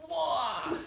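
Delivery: high-pitched imitated whoa; exaggerated yelp of shock; mock startled cry. Imitating a character's startled face close-up.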